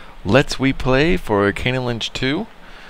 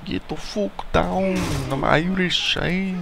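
bad Irish accent; 7 semitones higher, 4 dB quieter than usual. Player clowning during the game, bad Irish accent. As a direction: put on a badly faked Irish accent. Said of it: "That's a bad Irish accent".

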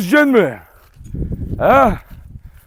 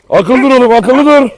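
loud, firm telling-off; loud warning to fighting dogs; scolding the dogs; 5 semitones higher, 7 dB louder than usual. Real, not acted. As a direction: snap at the dogs to behave.

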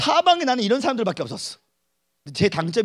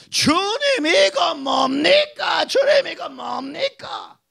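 higher, louder, slow sobbing wail; anguished cry; 8 semitones higher, 3 dB louder, much slower than usual. Acted. Preacher wailing to the Lord, sobbing and shouting. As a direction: cry out to God in despair. Crying out to God.

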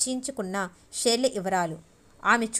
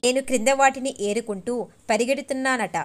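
usual speaking voice

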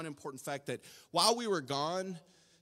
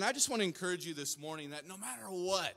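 usual speaking voice

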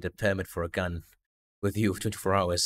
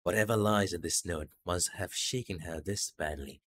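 normal talking voice

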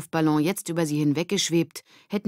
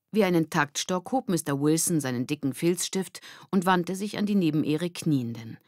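usual speaking voice

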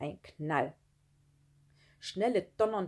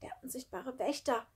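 raised, slightly hushed croak; lightly croaked; 7 semitones higher, 4 dB quieter than usual. A narrator's reading. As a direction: croak it a little, spooked. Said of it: "krächzte Sami". (leicht gekrächzt)

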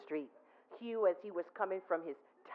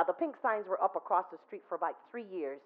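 ordinary delivery

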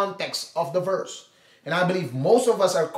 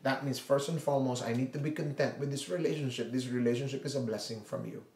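quiet prayer; 6 semitones lower, 10 dB quieter than usual. Pretend. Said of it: "you look at your marriage".

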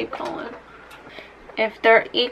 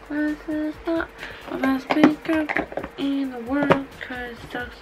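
softer, tossed-off aside; quiet aside; 3 semitones higher, 9 dB quieter than usual. Real, not acted. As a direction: mutter it honestly aside. Aside, dropped voice.

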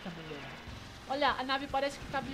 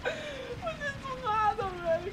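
laughing; 13 semitones higher, much slower than usual. Real, not acted. Laughing after a lucky shot.

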